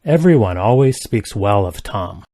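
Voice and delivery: normal talking voice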